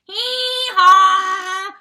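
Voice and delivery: loud, high hee-haw bray; donkey bray. A narrator's reading. The donkey protesting its fate with a loud bray. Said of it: "said the donkey".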